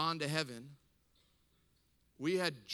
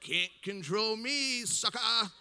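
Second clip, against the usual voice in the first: loud, higher mock-defiant crow; 6 semitones higher, 5 dB louder, slower than usual. Mom defying dad's order to stop singing, defiant. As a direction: taunt with drawn-out, raised voice. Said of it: "I think out of defiance".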